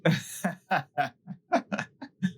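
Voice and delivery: laugh. Acted. Laughs at his answer.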